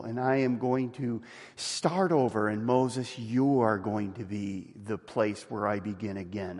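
soft God voice. Retold or imitated. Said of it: "he's saying to Moses".

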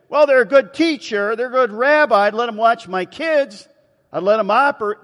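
high, loud mock-reasonable voice; mock endorsement. Imagined person excusing someone crackers, in loud mock approval. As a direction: pile up absurd praise, pitched high.

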